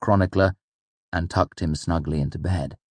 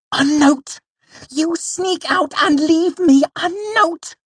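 high, loud, indignant cry; angry outburst; 6 dB louder, slower than usual. A narrator's reading. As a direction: fling it out in furious disbelief, voice pitched way up. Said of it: "he hissed angrily".

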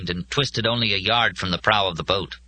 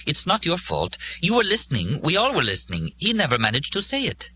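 high-pitched woman's voice, urging; insistent reassurance; 10 semitones higher than usual. A narrator's reading. Molly tells Joe it's not his fault, high and insistent. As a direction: lift the voice and press the reassurance.

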